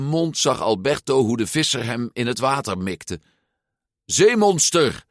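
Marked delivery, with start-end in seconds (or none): 4.0-5.0 high, slow, loud cry; a startled shout of sea monster; shouting fisherman. A narrator's reading. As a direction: yell it out across the water. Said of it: "riep Massimo".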